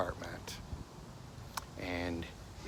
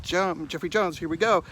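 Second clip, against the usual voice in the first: coaxing call; 10 semitones higher, 9 dB louder than usual. Retold or imitated. Calling the dog to move.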